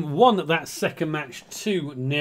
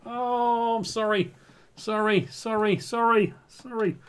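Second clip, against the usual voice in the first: high sheepish sorries; 7 semitones higher, slower than usual. Real, not acted. String of apologies to viewers.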